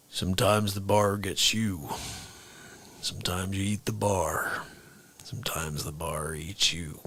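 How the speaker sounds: low, hushed cowboy musing; the Stranger's drawl. Retold or imitated. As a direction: mutter it like an old cowboy narrator. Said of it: "That's freaking everybody from the movie".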